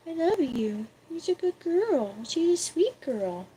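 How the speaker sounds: soft, high loving words to the cat; tender. Real, not acted. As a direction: murmur sweet nothings to the kitty.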